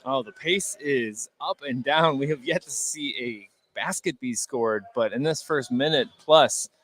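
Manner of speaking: ordinary talk, nothing marked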